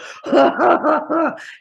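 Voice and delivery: exaggerated laugh thrown back in imitation; mocking fake laugh. Mocks the clerk back with laughter.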